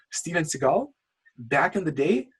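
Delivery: ordinary talk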